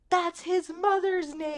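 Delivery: high, slow mock-desperate cry; dramatic reveal. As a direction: act out the big reveal, hammed up. Retold dramatic reveal by Lois.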